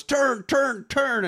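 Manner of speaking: high, pleading repeated turn; mock desperate pleading; frantic mock orders. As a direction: squeak the commands like a panicked goon. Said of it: "the other one goes".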